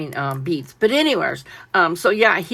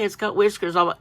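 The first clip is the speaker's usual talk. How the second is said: ordinary talk, nothing marked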